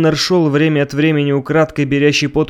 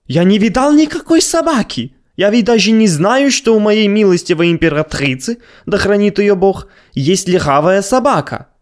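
indignant protest; 6 semitones higher than usual. Acted. Abner protests innocence.

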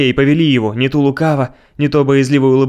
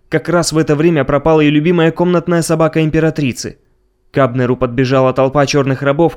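ordinary delivery

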